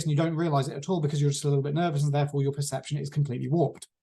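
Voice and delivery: nervous, racing presenter pace; rapid talking. Shown as a demonstration. Coach demonstrates a nervous presenter, talking very fast. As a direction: rattle it off without a breath. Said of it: "talking something more like this".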